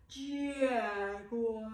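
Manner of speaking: drawn-out yawning word; jaguar yawn. Shown as a demonstration. Teacher yawning the word jaguar for the kids. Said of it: "Can you yawn like Jasmine".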